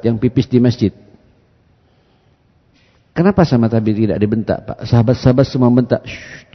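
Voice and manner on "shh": soft, retold shushing sound; a quick quieting shh; hushing shh. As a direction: hush the companions quietly. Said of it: "kata Nabi".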